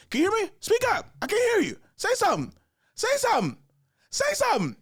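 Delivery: very high, loud repeated demands; impatient mother on phone. Retold or imitated. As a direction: demand an answer into a silent phone. Mother demands answer from silent son.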